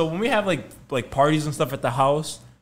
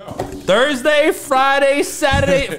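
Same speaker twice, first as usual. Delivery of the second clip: higher, louder, each day rising in disbelief; incredulous counting; 12 dB louder, much slower than usual. Real, not acted. Friend counting the days the pasta sat, loud and incredulous.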